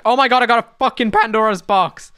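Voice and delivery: loud high stunned exclaim; excited. Real, not acted. Gamer opening a rare drop, loud excited exclaim. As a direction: blurt it out in disbelief.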